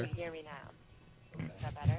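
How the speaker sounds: very faint, far-off call; weak phone line audio; over a landline phone. Real, not acted. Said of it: "I can't understand what you're saying".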